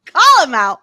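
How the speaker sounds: loud, high laughing shout. Real, not acted. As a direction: call him out while cracking up. Laughing callout.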